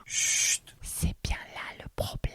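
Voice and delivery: a soft whispered secret; hushed whisper. Acted. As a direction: breathe it like a confession. Confidential hush in reflective monologue. (chuchoté, comme un secret)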